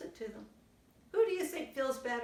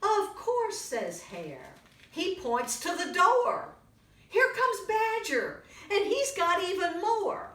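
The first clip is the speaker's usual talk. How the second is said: put-on lively read-aloud for little kids; storybook reading; 8 dB louder, slower than usual. A narrator's reading. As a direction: tell it brightly to a young audience. Reads picture book to children.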